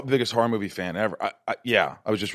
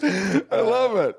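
drawn-out delight; 12 semitones higher, much slower than usual. Real, not acted.

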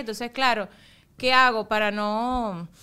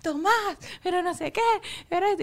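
higher-pitched mock telling-off; mock scolding; 8 semitones higher than usual. Mother imitating herself telling off her son, scolding. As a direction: scold a toddler in a raised pitch.

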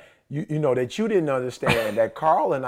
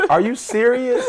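high, mocking incredulous question; retold derisive high-pitched query; scornful disbelief; 7 semitones higher, 3 dB louder than usual. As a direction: imitate her laughing inside as you ask. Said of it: "kinda like in her spirit".

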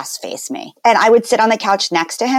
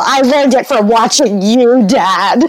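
imitating the old anti-drug ad kid, a bit louder; nineties commercial kid; 4 semitones higher, 4 dB louder, slower than usual. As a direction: quote the famous ad line with mock gravity. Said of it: "She's like the nineties commercial".

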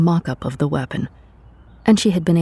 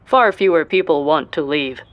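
standardized voice. A narrator's reading. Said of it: "voice standardization algorithm".

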